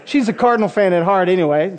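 normal talking voice

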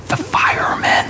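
put-on hushed little-kid whisper; small whisper. Child listing grown-ups in house, whispering. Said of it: "whispered Jimmy".

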